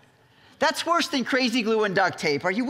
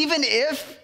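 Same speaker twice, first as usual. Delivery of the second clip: mock bravado, pitched up; 7 semitones higher than usual. Quoting Peter's boast to Jesus, with bravado. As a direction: swagger it like an empty boast. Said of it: "is the bravado".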